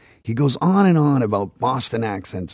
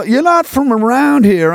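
high, loud Southern drawl; mock Southern accent; 10 semitones higher, 5 dB louder than usual. Southern clerk to out-of-town customer, high loud drawl. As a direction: size up the outsider in mock Southern. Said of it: "You're in the South now".

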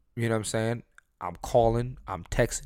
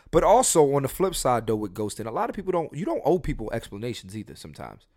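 ordinary talk, nothing marked

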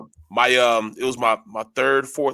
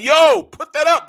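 high, loud retold warning; alarmed shout; 12 semitones higher, 7 dB louder than usual. Player to armed roommate in camp, alarmed high shout. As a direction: urgently call him off, pitched right up.

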